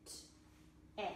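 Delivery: whispered "T-e" sounds; sounding out. Shown as a demonstration. Teacher checking the short vowel, whispered sounds. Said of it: "It's short".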